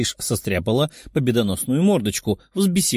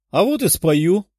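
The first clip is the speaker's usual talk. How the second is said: defiant; 5 semitones higher, 3 dB louder than usual. A narrator's reading. Girl defiantly accepts dare to sing.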